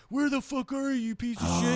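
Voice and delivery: hammed-up angry phone yell; mock angry. Calling lost friend's phone, mock furious. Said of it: "And I'm like".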